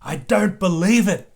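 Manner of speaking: breathless, amazed disbelief; gasped disbelief. A narrator's reading. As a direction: gasp it as if you can't believe your eyes. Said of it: "gasped Missus Kangaroo".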